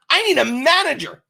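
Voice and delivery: a hammed-up furious shopper demanding the manager; mock angry demand. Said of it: "She comes up angry".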